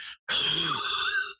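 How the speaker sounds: voiceless rattling wheeze; long mock chesty rasp; imitated rattly cough. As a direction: mimic an old man's rattling cough. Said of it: "every time he coughed, it sounded like".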